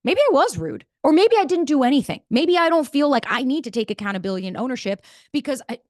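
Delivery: lightly played excuse-maker; mock self-justifying. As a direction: talk yourself out of blame. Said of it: "you're like".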